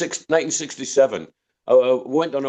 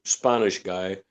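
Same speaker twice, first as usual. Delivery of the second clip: usual speaking voice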